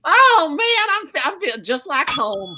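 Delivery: thrilled, feels like home; delighted. Acted. Texan client delighted by the coach's dad voice.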